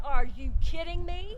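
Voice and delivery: retelling an incredulous protest, high and loud; indignant retort. Retold or imitated. Daughter protesting to the Lord, indignant.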